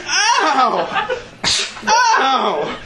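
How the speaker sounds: high laughing ow cries; mock pain cries. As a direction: yelp it high, half laughing. Arm injury, reenacted pain.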